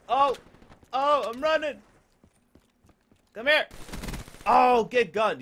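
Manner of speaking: high, loud bursts mid-fight; excited yelling. Real, not acted. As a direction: shout in the rush of combat. Sudden fight, running and attacking.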